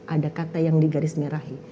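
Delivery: ordinary talk, nothing marked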